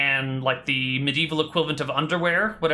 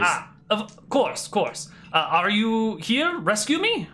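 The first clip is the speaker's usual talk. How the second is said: put-on halting foreign-accented prince; prince's broken Common; 6 semitones higher than usual. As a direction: switch to hesitant accented English, pitched up. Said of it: "could you stick to common".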